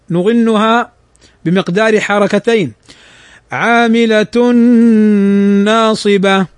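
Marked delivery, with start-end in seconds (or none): 3.5-6.5 Quran recitation. Shown as a demonstration.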